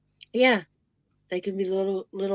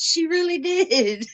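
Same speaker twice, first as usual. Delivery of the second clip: imitating Harley; 9 semitones higher, 7 dB louder, slower than usual. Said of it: "Harley goes".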